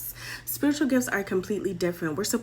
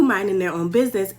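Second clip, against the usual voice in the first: plain reading voice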